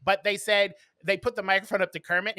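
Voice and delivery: ordinary delivery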